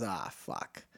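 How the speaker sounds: low, very soft grumble of resignation; reluctant bartender. Acted. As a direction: give in quietly, as the bartender. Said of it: "And the bartender goes".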